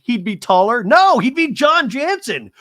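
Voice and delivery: high, loud teasing quip; wife teasing husband, high loud mock quip; mock Heather quip. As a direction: tease with high hammed-up punch.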